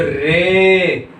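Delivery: slow "रे" with a long ए; drawn-out vowel. Shown as a demonstration. Teacher showing that Y sounds as ए, "रे" held long. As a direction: stretch "रे" out on its ए. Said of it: "इस वाई का pronunciation ए आ रहा है।".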